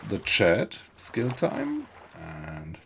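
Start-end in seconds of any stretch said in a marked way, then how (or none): 1.0-1.9 calls the chatter's name up high, sing-song. Real, not acted. Streamer greets chatter Skill Time with a high sing-song call. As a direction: greet the viewer with a bright high lilt.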